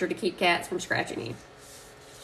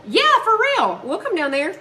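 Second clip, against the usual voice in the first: excited agreement; 9 semitones higher, 4 dB louder than usual. Real, not acted. Eager about road trip.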